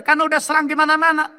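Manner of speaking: high and fast, brusque doctor; doctor explaining. Retold or imitated. Doctor telling the son the cancer has spread, fast high voice. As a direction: rattle off the reason quickly. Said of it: "kata dokter".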